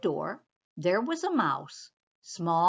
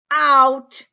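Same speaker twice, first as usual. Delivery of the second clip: huge, loud bear command; commanding shout; 4 semitones higher, 15 dB louder than usual. Acted. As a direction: blast one word to throw him out. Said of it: "commanded Bear".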